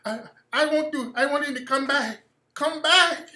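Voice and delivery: mock pleading. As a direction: whine for him to come back. Mocking someone begging for return.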